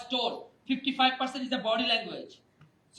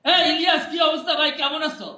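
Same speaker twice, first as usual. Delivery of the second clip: loud, higher boisterous greeting; talking too loudly; 5 semitones higher, 9 dB louder, much faster than usual. Acted. Greeting a colleague face to face, far too loudly. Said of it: "অনেকে জোরে কথা বলি".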